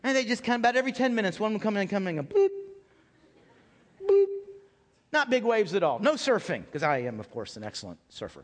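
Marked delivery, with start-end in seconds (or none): 2.2-4.3 mock wave bloop. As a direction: pop out a tiny wave noise. Mimicking weak waves.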